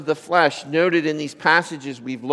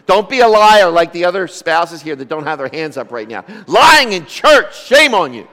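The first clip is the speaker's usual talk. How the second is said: louder, rising tease about lying in church; mock scolding; 8 dB louder than usual. Teasing congregation about lying.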